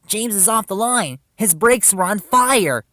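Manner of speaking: high, loud alarm report; urgent shout. A narrator's reading. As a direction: shout the news to rouse help. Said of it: "shouted Thomas".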